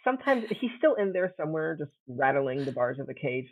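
usual speaking voice

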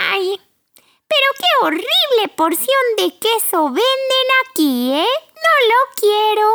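high, whiny disdainful mouse; spoiled brat voice. Acted. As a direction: sneer at the cheese. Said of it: "decía".

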